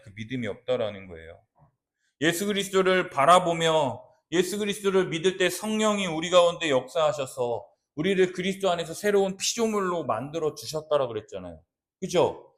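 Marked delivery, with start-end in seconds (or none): none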